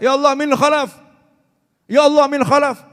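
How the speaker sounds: loud, high pleading cry to Allah; crying out to God. Retold or imitated. A scholar crying out to Allah so loudly the whole village runs out. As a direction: call out to God loud enough to wake the village. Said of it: "Sampai teriak".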